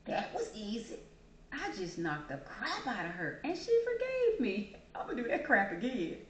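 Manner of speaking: lightly acted gloating offender; a hint of an amazed schemer thinking aloud; unrepentant attacker. Attacker marveling at being forgiven, lightly gloating. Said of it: "Guess what some people will do?".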